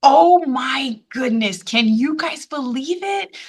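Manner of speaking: usual speaking voice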